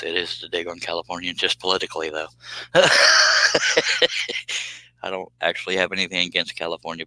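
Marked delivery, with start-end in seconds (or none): 2.3-4.8 loud, high burst of laughter; real laugh. Real, not acted. Host laughing after admitting his California dig, laughing. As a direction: laugh hard at your own dig.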